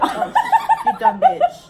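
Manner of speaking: laughing shriek. Real, not acted.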